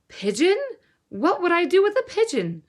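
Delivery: high, loud mock disbelief; fake surprise. A narrator's reading. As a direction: play innocent shock. Feigned shock in story.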